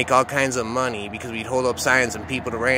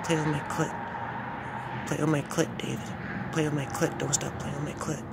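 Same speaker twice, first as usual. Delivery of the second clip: higher, soft woman's voice, over and over; breathy plea of the tiny woman, pitched up and hushed; softly pleading female voice; 7 semitones higher, 5 dB quieter than usual. Retold or imitated. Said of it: "And she's like".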